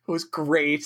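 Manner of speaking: ordinary talk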